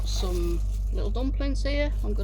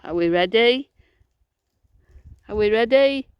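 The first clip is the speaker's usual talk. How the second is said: loud, rising call to the dog; 7 dB louder, slower than usual. Real, not acted. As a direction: rev the dog up, louder each time. Calling the dog before setting off.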